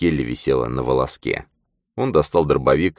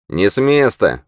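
a sharp, higher snapped command; curt order; 7 semitones higher, 4 dB louder than usual. A narrator's reading. Zane orders the others not to move, curt and sharp. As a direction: freeze them where they stand. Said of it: "бросил Зейн".

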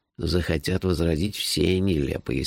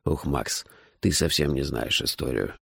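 ordinary delivery, nothing marked

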